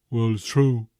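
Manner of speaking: put-on teen son defending his claim; sulky teenage son. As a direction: insist like a stubborn teen. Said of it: "Why are you talking like that?".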